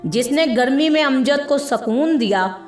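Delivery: ordinary delivery, nothing marked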